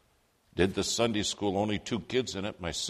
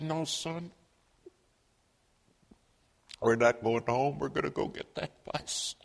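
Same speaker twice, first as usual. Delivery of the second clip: higher voice, as if through tears; retold tearful resolve; 6 semitones higher than usual. Crying mother to son after falling, retold tearful resolve. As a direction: refuse to give up despite the pain. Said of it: "she's crying".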